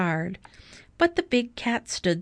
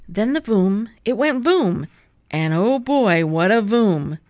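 louder, bursting with excitement at the voom; loud excited; 6 dB louder than usual. A narrator's reading. Narrator tells kids how Vroom goes voom, loud and excited. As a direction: boom it out like an explosion. Vroom blasts the snow clean.

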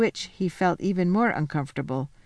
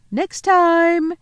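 high, loud call into the distance; 12 semitones higher, 8 dB louder than usual. A narrator's reading. The Queen calling back to Edmund from her sledge, high and loud. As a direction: call after him as the sledge leaves. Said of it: "calling out".

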